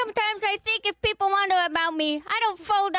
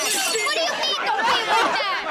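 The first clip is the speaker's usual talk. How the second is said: pitched up, furious repeat; angrier retort; 4 semitones higher than usual. Acted. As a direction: push the repeat harder and higher. Repeated, higher and louder.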